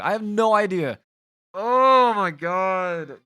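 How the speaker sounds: high, loud and drawn out in disbelief; real frustration breaking through, slow and loud; exasperated outburst. Real, not acted. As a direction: let it burst out, stretching oh my God. Recording software cut the ending.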